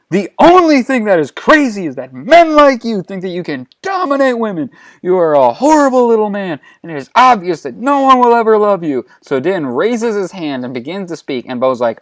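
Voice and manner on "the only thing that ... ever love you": high, loud ranting in outrage; furious tirade. Acted. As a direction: lash out at him, pitched high and loud. Acted furious tirade at a man.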